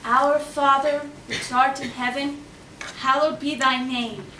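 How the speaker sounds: slow, careful recital of the prayer; reciting a prayer. Acted. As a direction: savour each line like music.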